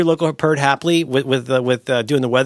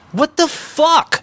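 What the fuck stretched slowly and higher; drawn-out disbelief; 11 semitones higher, much slower than usual. Pretend. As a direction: hang on each word of What the fuck in baffled disbelief. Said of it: "they can't just say".

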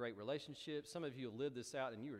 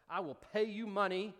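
higher, louder, eager bargaining; eager offer; 7 semitones higher, 8 dB louder than usual. Retold or imitated. Simon the magician offers money to disciples, eager mock offer. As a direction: sell it like a keen trader.

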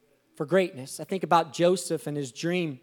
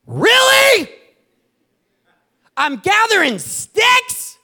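much higher and louder, slow incredulous complaint; exasperated outcry; 8 semitones higher, 9 dB louder than usual. Retold or imitated. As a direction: wail up high, sick of picking up sticks. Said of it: "you're like".

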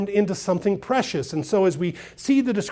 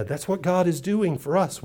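ordinary talk, nothing marked

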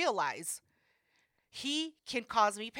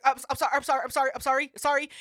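rapid, louder panicky sorrys; flustered apology; 4 semitones higher, 8 dB louder, faster than usual. Retold or imitated. Phlebotomist dropping the vial, panicky apologizing.

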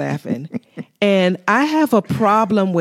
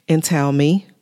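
ordinary talk

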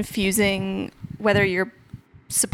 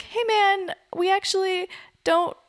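higher, gentle customer-service politeness; polite customer service; 6 semitones higher than usual. Retold or imitated. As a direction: ask nicely like a polite clerk. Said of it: "in the most polite, measured, like, customer service way".

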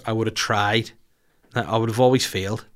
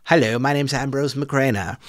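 lightly put-on prank caller voice; a hint of a silly made-up character; 4 semitones higher than usual. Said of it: "character ... prank phone calls".

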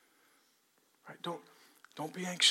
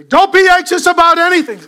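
shouted much higher and louder as an order; commanding shout; 13 semitones higher, 18 dB louder than usual. Shown as a demonstration. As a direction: bark it like a drill sergeant. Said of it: "They don't say".

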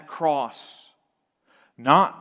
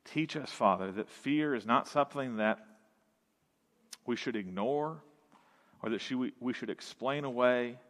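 lower, softer prayer voice; 5 semitones lower, 8 dB quieter, faster than usual. Acted. Pastor leading the church in closing prayer: low, soft, earnest. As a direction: pray it quietly to the Father.

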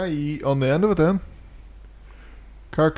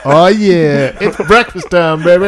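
hyped announcer; 4 semitones higher, 7 dB louder, slower than usual. Pretend. Hyping the bacon beer.

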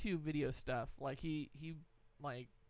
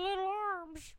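squeaky voice; 14 semitones higher, 5 dB quieter than usual. Pretend. Joking about T-Rex arms.

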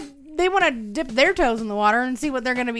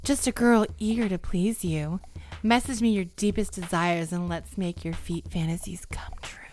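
low, hushed seductive voice; sexy voice; 6 semitones lower, 5 dB quieter than usual. Pretend. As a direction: purr it out like a flirty ad. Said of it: "let me get my sexy voice on".

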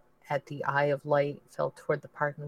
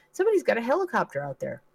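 excited; 8 semitones higher, 4 dB louder, faster than usual. Acted. Peering out at helicopter.

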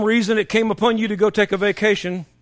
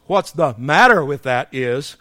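ordinary delivery

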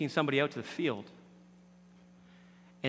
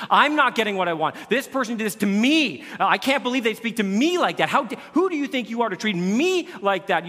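mock indignant; 7 semitones higher, 7 dB louder than usual. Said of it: "what you're gonna find out is this".

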